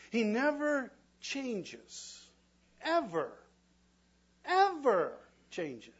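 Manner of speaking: preacher drawing out "Ever" for weight; slow emphasis. Real, not acted. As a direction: stretch out "He never changes. Ever." so each word lands. Preacher stressing God's constancy.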